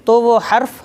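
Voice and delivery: ordinary talk, nothing marked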